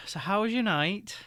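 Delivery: bitter question, said through her teeth; a bitter, tight-lipped question. Acted. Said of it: "she says with a bitter kind of".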